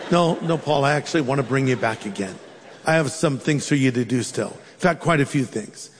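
acted patient, fatherly reply; calm divine reassurance. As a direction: gently overrule him, pitched low. Acts the Lord calmly overruling Paul.

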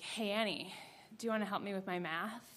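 hushed question, stress on you; quiet ask. Retold or imitated. Boy across the aisle quietly asking Annie for math help. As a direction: lean over and ask softly, stress on "you".

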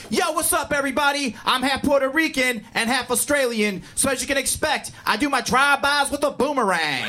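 high, pumped-up MC opener; hyped stage comic. Retold or imitated. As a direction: open big like a hype comic. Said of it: "the first guy came on stage".

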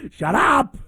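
high sharp mock yell of shut up; mock shout. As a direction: snap at her in jest. Snaps at crying woman.

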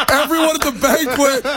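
giggling through the words, high; can barely read for laughing; laughing while reading. Real, not acted. Host cracks up reading text.

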